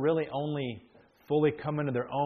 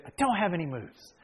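lightly imitated child's protest, pitched up; mock complaint from a stumped young player; a hint of a child protesting; 5 semitones higher than usual. Voicing his child at chess.

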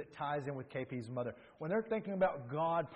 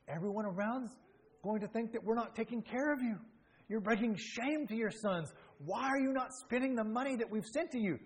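higher, lightly voiced scolding son; mock-reproach his mother, pitched up; imitating son; 8 semitones higher than usual. Son scolding his mother about the money, in a lightly voiced, higher, reproachful tone. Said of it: "he's like".

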